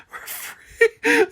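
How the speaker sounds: high wail, laughing through tears; full sobbing. Acted. Widow sobs at the grave. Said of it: "Sobbing more fully, released".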